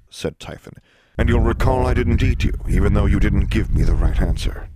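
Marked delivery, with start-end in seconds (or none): 1.2-4.7 loud, booming monster voice; louder, theatrical sphinx voice; riddling sphinx. Acted. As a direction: pose the riddle as a big beast.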